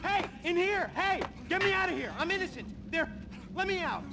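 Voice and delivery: calling out for help. Acted. Prisoner trapped calls for help.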